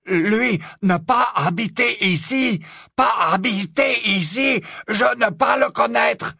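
put-on high, loud, slow broken French with a German accent; a terrified foreign woman pleading; frightened German accent. A narrator's reading. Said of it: "s'écria-t-elle".

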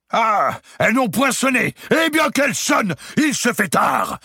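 very high, half-sung shout of a madman; Clermont's mad outburst. A narrator's reading. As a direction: crow it out, mocking the trumpets. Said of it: "dit Clermont".

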